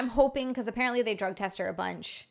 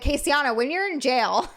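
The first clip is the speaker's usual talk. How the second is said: teasing call; 6 semitones higher, 8 dB louder than usual. Pretend. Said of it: "I'm gonna corrupt her and be like".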